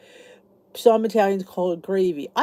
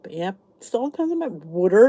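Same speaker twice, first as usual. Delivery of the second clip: ordinary talk, nothing marked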